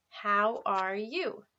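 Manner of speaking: slow, clear teacher modelling; slow model question. Shown as a demonstration. Teacher modelling a greeting slowly for students. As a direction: draw it out for learners to copy. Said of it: "repeat that with me".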